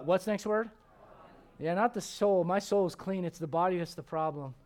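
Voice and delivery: ordinary talk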